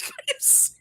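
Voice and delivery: tiny, very high re-enacted squeak; retold squeaky disbelief. Streamer retelling the PayPal surprise, tiny incredulous squeak.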